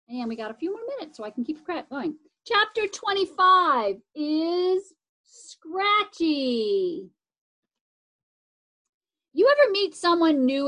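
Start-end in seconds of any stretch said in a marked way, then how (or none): 2.5-7.1 slow announced chapter heading; chapter title. A narrator's reading. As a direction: read the title out slowly. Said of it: "chapter title announcement".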